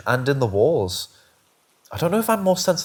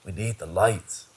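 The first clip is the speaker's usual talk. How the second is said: low, soft, slow and scared; hushed fear, dragging the words; frightened; 6 semitones lower, 5 dB quieter than usual. A narrator's reading. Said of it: "with empty and frightened eyes".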